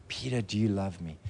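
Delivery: soft and low, searching; tender question. Acted. Jesus asking Peter about his love, soft and low. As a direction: ask it gently, hoping.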